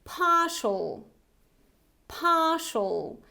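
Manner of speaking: partial without the R, as "PAH-shul"; British pronunciation. Shown as a demonstration. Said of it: "this is the British variety".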